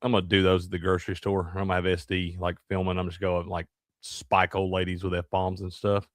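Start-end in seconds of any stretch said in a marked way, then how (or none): none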